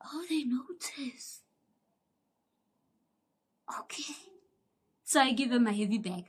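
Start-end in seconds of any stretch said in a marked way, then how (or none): 0.0-4.1 sarcastic whisper, slowly. Retold or imitated. Whispering to herself when the guard finally notices, slow and hushed. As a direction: whisper it in mock surprise. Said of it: "I'm like".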